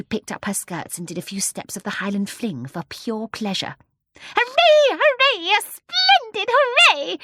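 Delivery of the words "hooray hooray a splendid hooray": put-on very high, loud little old-lady cheer; shrill cheering. A narrator's reading. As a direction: shout for joy in a shrill voice. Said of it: "cried in her shrill little voice".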